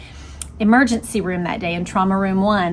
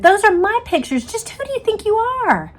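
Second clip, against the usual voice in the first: much higher, indignant outburst; outraged demand; 11 semitones higher than usual. A narrator's reading. Jean protests the agent taking her pictures, outraged. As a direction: throw it back at him in outrage. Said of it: "Jean demanded".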